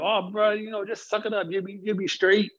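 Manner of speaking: high, breezy voice of a friend shrugging it off; high dismissive buddy. Retold or imitated. Friend on the phone brushing off a crying man, high and breezy. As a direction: toss it off lightly like a careless bro.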